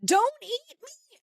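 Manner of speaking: high-pitched panicked New Zealander caricature; hammed-up New Zealand accent, pleading in a high voice; mock New Zealand accent. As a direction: squeal in fake fear with a put-on New Zealand accent. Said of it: "he's got the accent".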